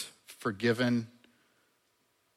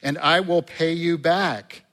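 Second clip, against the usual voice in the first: begging; 6 semitones higher, 7 dB louder than usual. Acted. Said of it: "he begged him".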